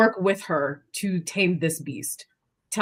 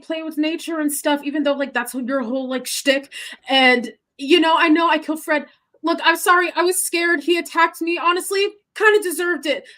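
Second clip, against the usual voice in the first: high, fast, rambling plea; frantic pleading Junie; 8 semitones higher, 6 dB louder, faster than usual. Acted. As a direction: babble an apology in a panic.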